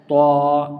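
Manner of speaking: تو said heavy and full-mouthed, held long; thick letter. Shown as a demonstration. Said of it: "موٹا".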